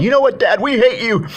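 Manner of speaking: high, loud mock bratty daughter; daughter's outburst. Daughter tells absent dad she hates him, high loud mock yell. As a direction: pitch it up and hurl it like a hurt teen. Daughter angrily rejecting her father.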